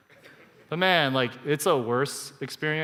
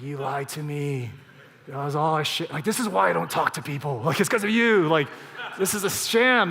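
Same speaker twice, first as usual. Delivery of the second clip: half-serious indignant tirade; betrayed rant; 5 dB louder than usual. Retold or imitated. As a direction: act out feeling duped, building in indignation. Acted inner rant at deceiver.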